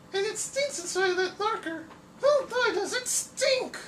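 very high, sweet falsetto damsel; swooning cartoon lady. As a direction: gush like a rescued heroine. Drawing now a lady character.

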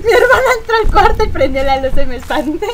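laughing through the words. Real, not acted. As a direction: explain it while still laughing. Laughing after the scare.

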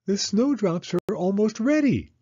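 put-on high child's voice; a small girl who can hardly wait; Penny telling Mama, high and eager; excited little girl. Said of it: "said Penny".

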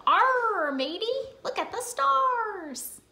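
high playful pirate voice. Pretend. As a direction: growl it like a cartoon pirate. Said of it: "the pirate's ... they go".

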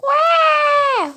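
loud high mock baby cry; hammed-up baby wail; fake baby wail. Baby crying in game.

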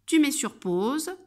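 ordinary talk

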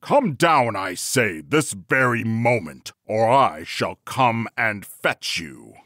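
loud, commanding husband, slowing at the end; bawled threat. A narrator's reading. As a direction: bawl the order, then drag out the threat. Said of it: "Bluebeard now again bawled out".

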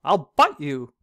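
loud threatening cry; goat's cry. Acted. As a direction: threaten him, loudly. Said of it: "cried a goat".

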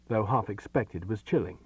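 ordinary delivery, nothing marked

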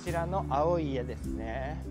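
ordinary talk, nothing marked

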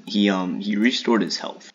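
ordinary delivery

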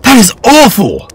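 high, loud yell of disgust; loud appalled yell. Acted. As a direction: cry it out, appalled. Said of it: "I yelled".

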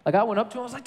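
ordinary talk, nothing marked

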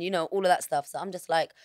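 ordinary delivery

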